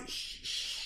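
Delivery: ordinary talk, nothing marked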